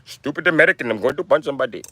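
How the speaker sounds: put-on Indian accent threat; mock Indian accent. Shown as a demonstration. Indian man threatening to punch somebody, mock Indian accent.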